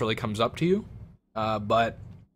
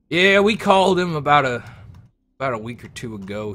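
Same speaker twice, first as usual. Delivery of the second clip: captain; 4 semitones higher, 6 dB louder than usual. Acted. Captain answers player.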